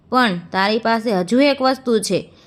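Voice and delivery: ordinary delivery, nothing marked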